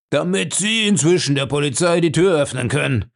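a touch of taunting contempt; mocking. A narrator's reading. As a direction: needle him with scorn. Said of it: "meinte Bellamy höhnisch".

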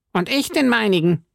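high, eager musketeer voice; Porthos. Acted. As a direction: chime in high and keen. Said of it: "sagte Porthos". (hohe, eifrige Musketierstimme)

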